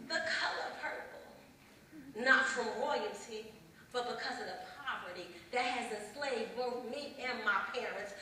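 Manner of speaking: ordinary talk, nothing marked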